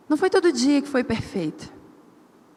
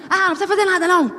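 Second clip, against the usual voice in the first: higher, snippy brush-off; mock curt refusal; 6 semitones higher, much faster than usual. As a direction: refuse the help with a huff. Recusa ríspida imitada. (recusando a ajuda do marido, mais agudo e ríspido)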